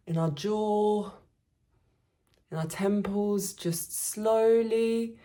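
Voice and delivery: slow, drawn-out meditation voice with long pauses; unhurried, soothing body-scan delivery; guided meditation. Acted. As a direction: guide listeners gently through releasing tension. Leads a body relaxation meditation.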